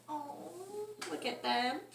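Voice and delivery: soft sad. A narrator's reading. Bunny sad about feet.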